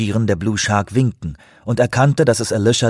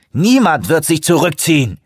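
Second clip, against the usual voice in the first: lightly raised defiant shout; mild shout; 7 semitones higher, 4 dB louder than usual. A narrator's reading. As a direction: refuse loudly, with some heat. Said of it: "schrie er". (leicht gespielter Schrei)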